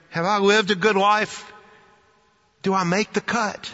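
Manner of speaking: high-pitched, searching self-questioning; wondering. Acted. Us looking at our own gravestone, anxious wondering. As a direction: wonder it aloud, voice lifted high. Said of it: "at our own gravestone wondering".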